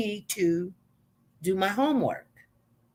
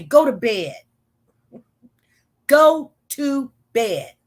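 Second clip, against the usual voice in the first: louder, slow, no-nonsense order; firm command; 2 semitones higher, 5 dB louder, slower than usual. Retold or imitated. Babysitter orders the boy to bed, loud, slow and stern. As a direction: lay down the law, slow and firm.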